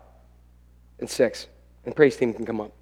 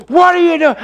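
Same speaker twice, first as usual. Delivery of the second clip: high, loud retold outburst; raging; 13 semitones higher, 6 dB louder than usual. Dad hearing about broken go-kart, raging. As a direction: explode in acted rage.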